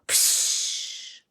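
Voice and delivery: whispered psh of fire going out; hiss. Acted.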